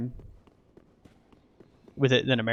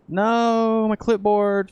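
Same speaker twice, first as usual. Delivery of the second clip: dismayed cry; 7 semitones higher, 4 dB louder, much slower than usual. Real, not acted. Loses clipboard content.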